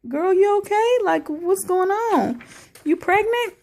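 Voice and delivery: high, startled, worried questions; concerned disbelief. Retold or imitated. Retelling the show, she asks the sick castmate: high alarmed concern. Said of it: "I was just like".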